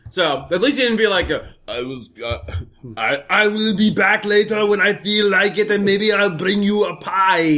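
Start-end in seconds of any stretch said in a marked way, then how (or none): 1.7-7.6 slow, lightly voiced silly Arnold; silly accent. Man voices Arnold rambling, slow silly accent. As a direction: drawl it out in a silly mock accent. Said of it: "You make him sound like he's part Indian".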